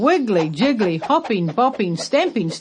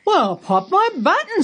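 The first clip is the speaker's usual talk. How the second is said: much higher, delighted gasp of surprise; amazed exclamation; 12 semitones higher than usual. Acted. Grandpa amazed by tree.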